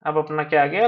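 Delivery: ordinary talk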